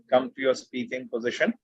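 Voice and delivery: ordinary talk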